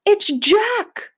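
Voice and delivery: high, loud panicked giant bellow. A narrator's reading. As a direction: terror at the name of Jack. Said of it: "bellowed the flesh lump eater".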